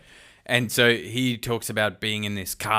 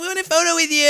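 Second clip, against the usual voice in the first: squealing fan voice; much faster than usual. Pretend. Mock fan voice, very high.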